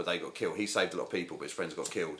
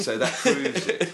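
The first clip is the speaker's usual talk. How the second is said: mock triumphant proof; 6 semitones higher, 5 dB louder, slower than usual. Deadpan mock conspiracy logic.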